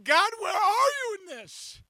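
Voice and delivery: high, pleading cry; desperate plea, voice lifted; anguished cry to God. Retold or imitated. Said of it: "I'm like".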